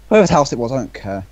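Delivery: ordinary delivery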